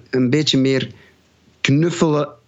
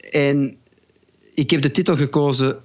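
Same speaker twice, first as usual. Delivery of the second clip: ordinary talk, nothing marked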